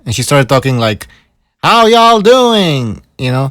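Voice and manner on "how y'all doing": slow, high put-on Southern drawl "How y'all doing?"; Hillary doing a fake Southern greeting; mock Southern accent. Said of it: "she changed her accent".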